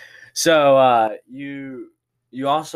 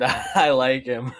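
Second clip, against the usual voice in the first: slow, higher imitation through laughter; mock stutter; 5 semitones higher, slower than usual. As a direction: draw out a teasing, stuttered imitation. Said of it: "a very stuttered".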